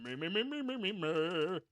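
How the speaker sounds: silly "me-me-me" voice exercise; rising, then falling warm-up on me; mock vocal warm-up. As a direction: run a goofy throat-clearing warm-up. Mock vocal warm-up before reading.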